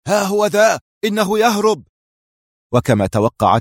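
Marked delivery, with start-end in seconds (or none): 0.1-1.8 high, urgent pursuer's yell; high-pitched excited shout; shouted alarm. A narrator's reading. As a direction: cry out that you've spotted him. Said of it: "دوى صوت يهتف".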